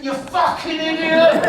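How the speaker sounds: high, drawn-out loud yell; Granddad's angry shout. Retold or imitated. Child imitates Granddad shouting when he's driving, angry shout. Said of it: "what does he say to you?".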